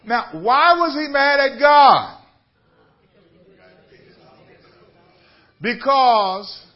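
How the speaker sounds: high, loud, calling out to the congregation; preaching at full force. Acted. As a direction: drive the question home like a pulpit preacher.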